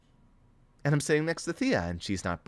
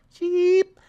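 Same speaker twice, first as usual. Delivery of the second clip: squeaky cheep imitation; 10 dB louder than usual. Excited narrator mimicking a robin's sharp cheep, very high.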